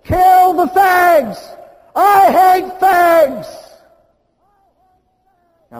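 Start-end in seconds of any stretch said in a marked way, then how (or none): 0.0-3.8 slow, high, much louder hateful yell; shouting at the top of his lungs. Retold or imitated. Man on the bridge yelling slurs at the couple, screamed. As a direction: bellow it across the park in hate. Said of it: "Starts shouting at the top of his lungs".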